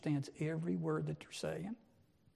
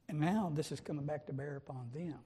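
ordinary talk, nothing marked

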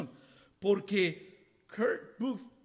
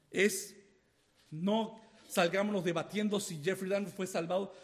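ordinary talk

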